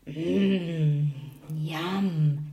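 relishing a tasty smell; savouring. A narrator's reading. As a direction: savour it with a long, greedy mmm. Tom relishes the pudding mix.

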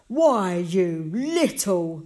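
loud, slow, indignant splutter; gasped outrage. A narrator's reading. Mister Bragg as Josh runs off, outraged. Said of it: "Mister Bragg gasped".

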